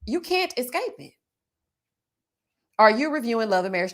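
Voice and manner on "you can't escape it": usual speaking voice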